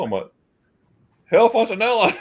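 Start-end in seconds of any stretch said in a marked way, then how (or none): 1.2-2.2 laughing delight. Real, not acted. Meets the famous owner.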